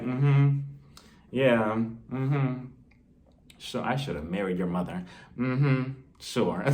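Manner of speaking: low, soft grumbled mm-hmms; mumbling husband. Retold or imitated. A bored husband humoring his complaining wife, low and mumbled. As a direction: mutter along, half listening. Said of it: "he's just walking around like".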